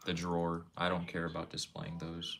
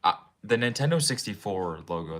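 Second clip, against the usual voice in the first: ordinary delivery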